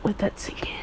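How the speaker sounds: breathy dramatic whisper aside. Real, not acted. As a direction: whisper it to let it land. Aside joke about [name].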